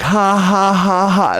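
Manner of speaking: high put-on ha ha; mock laugh. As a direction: fake a goofy open-mouthed laugh. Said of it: "Or I'll just like".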